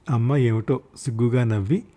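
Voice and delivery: ordinary delivery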